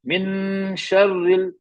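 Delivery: मिन शर्रिल drawn out very slowly as a model; slow Quran recitation demo. Shown as a demonstration. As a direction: stretch शर्रिल long and slow. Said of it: "बता बेटा".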